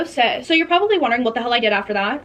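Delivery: ordinary talk, nothing marked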